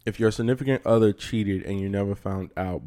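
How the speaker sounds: ordinary delivery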